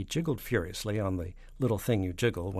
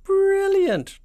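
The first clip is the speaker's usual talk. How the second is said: very high, very loud delighted outburst; falsetto cry; 12 dB louder than usual. A narrator's reading. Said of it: "cried the chief spy".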